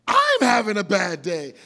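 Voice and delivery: indignant. Pretend. Third person, upset.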